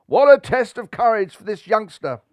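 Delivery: loud, high commentator at fever pitch; breathless radio commentator; excited commentary. A narrator's reading. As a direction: call it like a thrilled cricket commentator.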